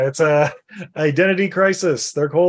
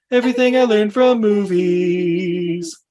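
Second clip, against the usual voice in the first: slow, drawn-out sponsor name; slow emphatic; slower than usual. Acted. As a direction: spell it out slowly for emphasis.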